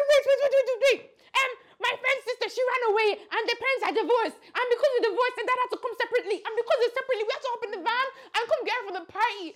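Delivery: high, loud, rushed babble; frantic excuse. Retold or imitated. Caught daughter gabbling a fake story to mom, frantic. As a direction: blurt the story out fast to save yourself.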